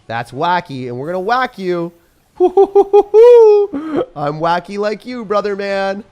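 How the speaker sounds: building to a high, loud whoop; wacky whooping. Acted. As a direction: go loud and zany, pitch climbing into a drawn-out cry.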